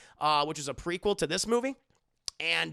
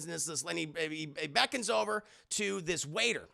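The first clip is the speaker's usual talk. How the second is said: usual speaking voice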